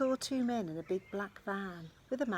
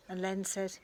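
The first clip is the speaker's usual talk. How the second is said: usual speaking voice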